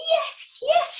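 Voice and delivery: high, loud eager yelp; granny crying out for the hat; excited cry. Acted. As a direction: cry out with glee. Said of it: "she cried".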